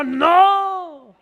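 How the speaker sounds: very loud, high drawn-out no; comic frantic outburst; panicked denial. Acted. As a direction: wail a hammed-up refusal. Peter denies again, acted.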